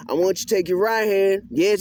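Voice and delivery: ordinary talk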